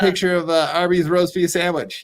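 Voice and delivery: high mock-agreeable character voice; Ray DeVito. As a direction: put on a high voice, offhandedly agreeing. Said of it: "He was like".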